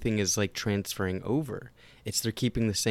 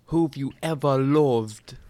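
slow, louder mock British accent; British accent impression; 5 semitones higher, 6 dB louder, slower than usual. Co-host mimicking the actress's lines in a British accent. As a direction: put on Mera's British accent. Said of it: "you spell it out phonetically".

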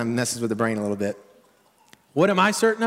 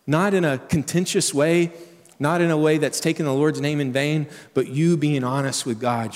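ordinary delivery

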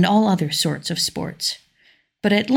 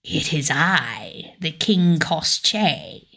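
voice from the well; slower than usual. A narrator's reading. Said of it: "said a voice from the well".

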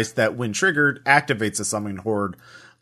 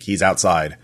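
ordinary delivery

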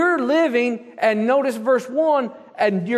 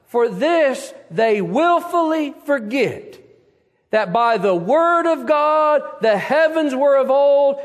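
loud, higher preaching, driving on; preaching at full force; 4 semitones higher, 4 dB louder than usual. Acted. Pastor exhorting his congregation, full-force preaching. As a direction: preach it out to the pews.

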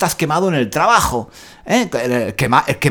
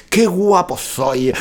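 mock self-admiring; much slower than usual. Admiring himself in the mirror.

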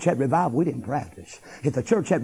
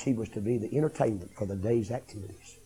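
ordinary talk, nothing marked

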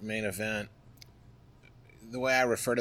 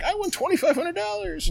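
much higher, triumphant boast; proud announcement. Retold or imitated. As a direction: show off the win to her. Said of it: "And I'm like".

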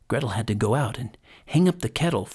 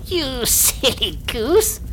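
high, slow crone scolding; scornful hag; 11 semitones higher, much slower than usual. A narrator's reading. Hag scolds Gretel at the oven, crone voice. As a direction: chide her in a drawn-out witch voice. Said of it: "said the hag".

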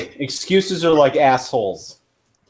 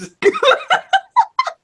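high squealing laugh. Real, not acted. Joke about Kevin's chat name.

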